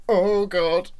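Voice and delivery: high, loud retold dismay; dismayed oh God. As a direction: yelp it as if caught off guard. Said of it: "So I was like".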